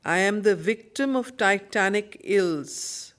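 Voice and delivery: ordinary delivery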